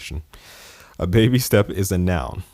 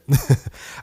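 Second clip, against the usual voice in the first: short amused chuckle; laugh; 8 semitones higher than usual. Real, not acted. Podcaster laughing at his teenage brush-off. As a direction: laugh at his younger self. Laughs at own youthful attitude.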